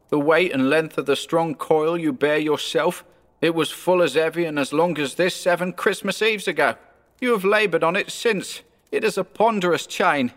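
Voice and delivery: high-pitched ghostly lament; mournful ghost. Acted.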